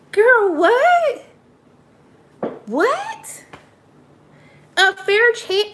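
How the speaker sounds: stunned by what she just heard; disbelief. Real, not acted. Host reacts to Paige wanting a fair chance, high and incredulous.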